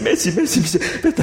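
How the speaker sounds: high, startled questions. Retold or imitated. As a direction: sound alarmed and high. Wife asks what happened.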